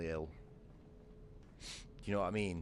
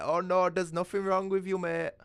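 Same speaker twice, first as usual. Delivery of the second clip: higher, louder dismissive doctor impression; mocking doctor; 12 semitones higher, 8 dB louder than usual. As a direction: mimic a doctor brushing you off, higher and louder. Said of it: "some fucking Gupta doctor who's like".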